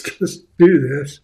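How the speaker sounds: "Just do this" slow and loud; blunt order. Retold or imitated. Coach mocking the boss's note, slow and loud. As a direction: spell it out bluntly, word by word.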